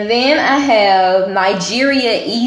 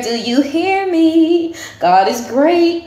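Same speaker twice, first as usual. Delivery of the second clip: higher, slow, drawn-out sassy call; 9 semitones higher than usual. Real, not acted. Proud of her DNA results, calling out to viewers with high, slow sass. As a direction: stretch it out like you're daring them to argue.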